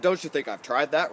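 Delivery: mildly indignant pushback; a hint of put-upon protest; defensive retort. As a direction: argue back at the coach, a touch defensive. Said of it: "I'm gonna argue for a second".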